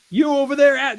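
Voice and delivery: put-on high, loud Louisville accent, calling out. [name] calling him over, loud high Louisville accent. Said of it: "in his Louisville accent".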